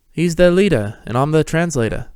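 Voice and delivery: lightly voiced Peter; Peter's voice. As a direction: announce yourself with pride. Peter appears behind.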